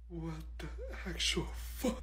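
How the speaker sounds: unvoiced, very soft disbelief; whispered horror; shocked whisper. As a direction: hiss it to the desk mate.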